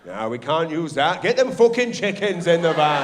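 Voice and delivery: a mob boss barking about chickens; mock crime boss. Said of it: "crime syndicate".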